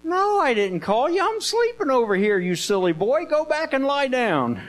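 high, protesting scolding; acted exasperation. Eli woken twice telling Samuel to lie down, play-acted exasperation. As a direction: shoo the child off in high-pitched irritation.

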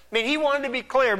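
usual speaking voice

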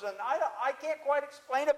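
normal talking voice

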